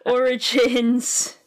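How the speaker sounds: slow, stretched out word; drawn-out reading. Acted. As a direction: say Origins slowly, syllable by syllable. Repeats word slowly.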